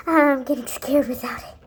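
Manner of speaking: breathy frightened whisper; whispered childish fear; scared whisper. Acted. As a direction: confess your fear under your breath.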